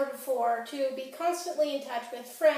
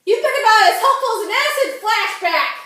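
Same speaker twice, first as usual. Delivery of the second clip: loud, high-pitched parting insult; full-voiced angry yell; furious shout; 8 semitones higher, 10 dB louder than usual. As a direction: hurl the insult down the phone.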